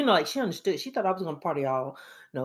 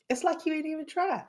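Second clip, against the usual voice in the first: scolding self; 12 semitones higher than usual. Retold or imitated. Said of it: "I'm like".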